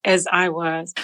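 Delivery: ordinary delivery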